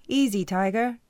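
calming tease. Acted. Coach teasing the furious heroine to calm down. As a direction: soothe her like a riled cat.

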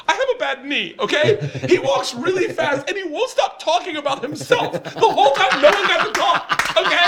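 high and laughing while imitating Jenna; can barely get her complaints out for laughing; laughing through the quote. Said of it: "first thing she goes".

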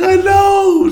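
sudden high mock shriek of an upset mom; mom's shriek. Son imitates mom on the phone, high shrill mock shriek. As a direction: squeal it like a frantic mother.

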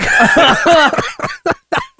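squealing laugh; high-pitched laugh. Real, not acted. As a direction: let out a high giggle. Laughing at reaction.

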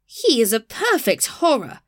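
high-pitched mock scream of horror; hammed-up cactus outrage. A narrator's reading. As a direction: exclaim it in theatrical disgust. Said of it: "screamed the cactus".

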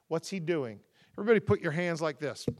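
ordinary talk, nothing marked

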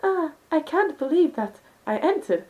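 breathy, slow, overacted anime girl; hammed-up manga girl.

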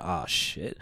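low dread-filled mutter; hushed oh shit. Retold or imitated. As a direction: whisper it in sudden dread. Reaction to I love you.

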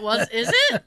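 loud startled question; surprised outburst. Retold or imitated. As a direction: blurt it out, surprised. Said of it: "And I went".

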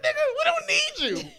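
very high, loud scoffing retort; high-pitched, laughing dismissal; acted protest. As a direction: squeal it in mock outrage, half laughing. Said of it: "I'm like".

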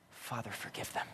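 very soft dying whisper; whispered prayer. Retold or imitated. Jesus prays for his tormentors, whispering. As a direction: breathe out a prayer with last breaths. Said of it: "His whispered prayer with his last breaths".

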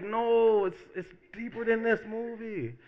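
high-pitched, exaggerated insistence; defensive protest. Moviegoer explaining his tears to teasing friend, defensive.

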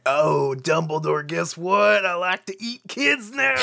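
high, goofy casual monster; mocking werewolf voice. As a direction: play the villain as a goofball. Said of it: "being like".

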